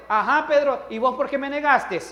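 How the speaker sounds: loud, high reproach to Pedro; mock scolding. Imagined Jesus scolding Peter.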